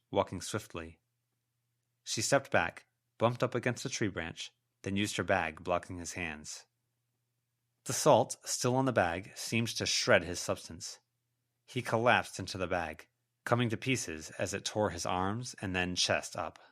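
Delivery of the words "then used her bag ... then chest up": plain reading voice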